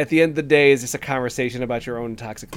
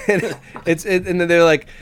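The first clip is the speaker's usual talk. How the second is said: ordinary talk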